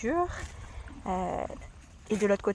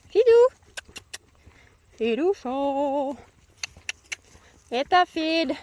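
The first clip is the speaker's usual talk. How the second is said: calling out to horse; 5 semitones higher, 8 dB louder, slower than usual. Real, not acted.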